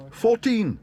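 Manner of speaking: put-on very high, loud young bear's shout. A narrator's reading. Paddington jumping into the bidding, shouting. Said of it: "shouted Paddington".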